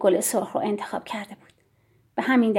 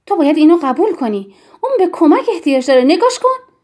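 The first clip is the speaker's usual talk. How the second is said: higher, louder, urgent insistence; blazing anger; 5 semitones higher, 6 dB louder than usual. A narrator's reading. Tiri argues with Yann about the child, blazing anger. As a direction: press the point angrily, climbing high at the end.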